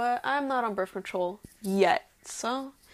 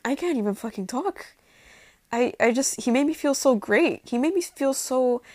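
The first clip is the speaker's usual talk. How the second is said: normal talking voice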